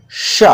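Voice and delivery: श said retroflex, tongue curled back as for टा; retroflex sha. Shown as a demonstration. Teacher demonstrating the retroflex श to the class. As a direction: curl the tongue back and hiss श. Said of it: "वैसे मोड़ेंगे और कहेंगे".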